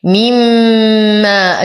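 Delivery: مما with a long nasal hum on the doubled م; very slow, sustained م in مما; fullest ghunna. Shown as a demonstration. Teacher showing complete ghunna, long hummed م in مما. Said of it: "غنة أكمل ما تكون".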